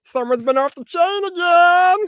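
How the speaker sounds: very high, loud, slow cartoon shriek. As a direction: scream it like a cartoon. Cartoon voice shouted.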